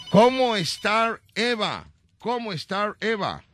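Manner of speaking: put-on high, slow Spanish with a gringo English accent; mock English. Host quizzing little Eva in English, mock English accent. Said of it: "La pregunta en inglés".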